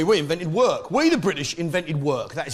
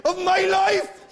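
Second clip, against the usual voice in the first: put-on tormented Welsh accent; 13 semitones higher than usual. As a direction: wail wedding vows in an agonised Welsh accent. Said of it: "he sounds as though he's dying of some inner fucking hurt and torment".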